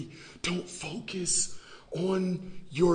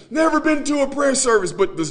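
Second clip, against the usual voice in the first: loud, higher, poking fun at the showy worshipper; mock excited; 9 semitones higher, 12 dB louder than usual. Preacher teasing showy believers on camera, loud mock excitement. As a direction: ham up the camera-ready piety. Mocking a show-off believer, raised.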